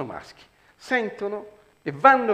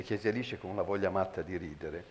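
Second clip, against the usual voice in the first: normal talking voice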